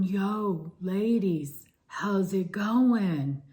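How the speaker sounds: slow, drawn-out surfer greeting; laid-back surfer dude. Retold or imitated. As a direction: stretch it out like a chilled beach guy. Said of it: "And he's going".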